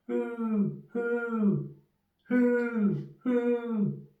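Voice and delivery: high, drawn-out hooting owl. Acted. The owl calls outside the house: high hooting. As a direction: hoot each who like an owl in the night.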